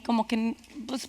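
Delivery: normal talking voice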